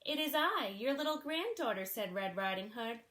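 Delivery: put-on lively read-aloud for children; storybook reading. A narrator's reading. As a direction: read it like a bedtime picture book. Reads a picture book aloud.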